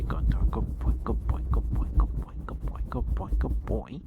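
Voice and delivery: ordinary talk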